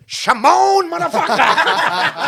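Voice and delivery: high, loud jeering yell at a mate; mock shout. Teasing his friend Shimon with a loud high jeer. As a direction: bellow his name like a taunt.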